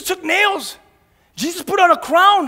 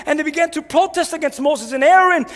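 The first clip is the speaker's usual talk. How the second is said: normal talking voice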